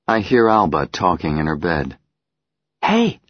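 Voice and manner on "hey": put-on high child's call; little girl voice. Child Alba calling out.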